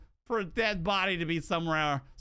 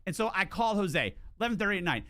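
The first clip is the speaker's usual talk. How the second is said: ordinary talk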